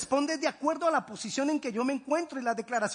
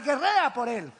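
usual speaking voice

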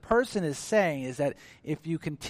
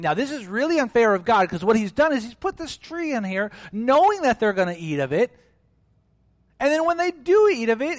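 higher, louder protest that it's unfair; indignant complaint; 10 semitones higher, 8 dB louder than usual. Retold or imitated. Preacher voicing people who call God unfair, indignant. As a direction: voice the objector's outrage at God. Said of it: "And some people think".

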